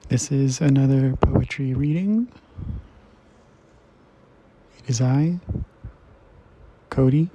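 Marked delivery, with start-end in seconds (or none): 4.8-7.3 "It is I", long pause, then "Cody"; dramatic pauses. Real, not acted. Host introducing himself to listeners, with dramatic pauses. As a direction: hang a theatrical pause before "Cody". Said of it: "why all the dramatic pauses".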